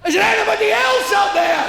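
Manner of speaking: very high, loud cry; desperate shout. Acted. Man giving up on God, shouting for anyone else. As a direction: yell past God for someone else.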